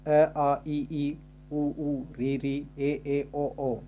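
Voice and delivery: each vowel said separately and clearly for the class; reciting the vowels. Shown as a demonstration. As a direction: recite अ, आ, ई, ई one at a time like a teacher. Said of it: "जैसे". (स्वरों को अलग अलग साफ़ बोलना)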